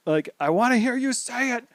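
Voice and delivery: high strained passionate call; heartfelt shout. Retold or imitated. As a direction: yell across to her, begging. Luffy shouting at Robin.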